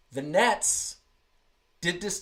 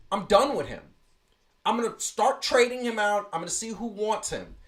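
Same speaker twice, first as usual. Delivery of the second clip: ordinary delivery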